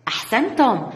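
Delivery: ordinary talk, nothing marked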